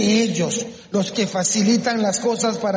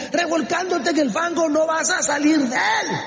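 full-force preaching; 9 semitones higher than usual. Real, not acted. Preacher urging the congregation to rise, full-force preaching. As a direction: drive each phrase home, high and stretched.

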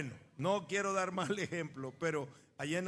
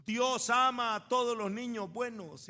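priest's announcement; 5 semitones higher, 6 dB louder than usual. Retold or imitated.

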